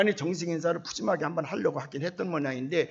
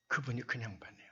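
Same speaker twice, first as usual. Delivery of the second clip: hushed aside; 7 semitones lower, 10 dB quieter than usual. Retold or imitated. Repeats the casual introduction, hushed.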